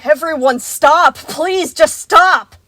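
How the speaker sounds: loud, slow commanding yell. A narrator's reading. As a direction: yell over everyone. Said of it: "Freddy's yells smothered their bickering".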